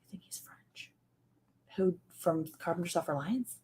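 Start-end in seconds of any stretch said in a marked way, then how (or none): 0.1-0.9 hushed, unvoiced question; a secret whispered question; whispered aside. Real, not acted. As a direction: whisper it so only one person hears.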